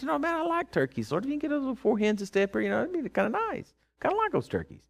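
high, soft, wheedling request; casual pleading. Retold or imitated. Man asking the Lord for turkeys, high casual pleading. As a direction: ask a small favour in a light, high voice.

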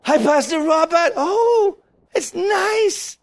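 high, put-on cooing greeting; hammed-up high-pitched niceness; mock polite churchgoer. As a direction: parody a feebly pleased congregant. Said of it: "would sit there and go".

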